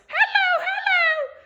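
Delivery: very high, squeaky pretend dog; girl dog voice. Imitating mum's talking dog for the lads, high girly voice. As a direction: put on a tiny, very high girl's voice. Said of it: "She talks like this".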